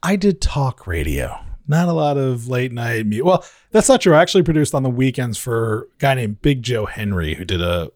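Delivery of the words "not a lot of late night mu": ordinary talk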